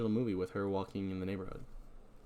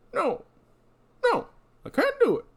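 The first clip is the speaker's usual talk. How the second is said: put-on old prospector; 5 dB louder than usual. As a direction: whine it like a rattled old codger. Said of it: "It just really works for this voice".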